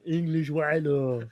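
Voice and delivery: put-on slow laughing French accent; drawn-out mock French accent. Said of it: "we only hear French accent".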